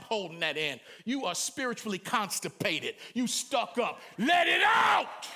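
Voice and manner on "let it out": much higher, louder, slow exhortation; preaching shout. Real, not acted. Preacher urging the congregation to let it out. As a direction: drive it home, shouting.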